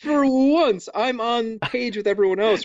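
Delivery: high-pitched, playful indignation; flustered protest. Real, not acted. Comic protest at being teased.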